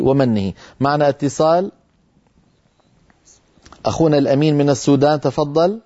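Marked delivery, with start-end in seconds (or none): none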